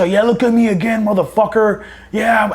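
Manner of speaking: high-pitched mock threat; retold yelling. Marines behind the formation cussing at boots, mock yell. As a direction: imitate a heckler cussing someone out, not full volume. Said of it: "still fucking yelling and cussing at us".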